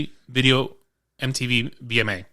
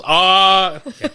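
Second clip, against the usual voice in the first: mock surprise; 6 semitones higher, 6 dB louder, much slower than usual. Bronx lady reacting, retold.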